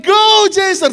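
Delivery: high, loud frantic cheer; wild shout. Retold or imitated. Crazed father being dragged from the poolside, wild shout. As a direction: bellow it while being pulled away. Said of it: "Ditarik saya seperti orang gila".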